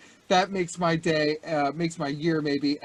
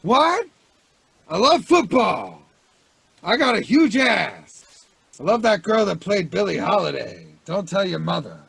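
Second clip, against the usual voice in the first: loud gung-ho soldier; 2 semitones higher, 6 dB louder than usual. Acted. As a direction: bark it out like a crazed colonel. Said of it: "I'll do Al Pacino being startled awake".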